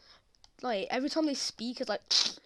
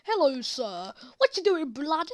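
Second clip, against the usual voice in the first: louder, higher street-slang impression of a posh girl; hammed-up roadman greeting; mock roadman slang; 5 semitones higher, 7 dB louder than usual. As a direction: put on the London street talk, brash. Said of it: "all her mates speak like that".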